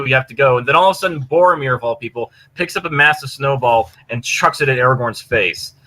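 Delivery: usual speaking voice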